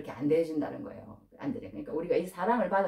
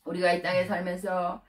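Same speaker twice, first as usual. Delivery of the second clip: prayer. Real, not acted. Preacher leading the congregation in prayer.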